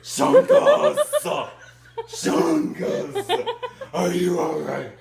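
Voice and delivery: high, loud hammed-up yell; mock roar. Character calling for his missing friend, hammed-up roar. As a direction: bellow the name in panic.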